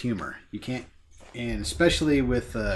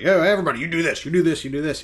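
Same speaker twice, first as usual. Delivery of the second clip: louder, higher call to the team; mock commanding; 5 semitones higher, 6 dB louder, faster than usual. Captain America lays out his battle plan, mock commanding. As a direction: rattle off orders like a captain.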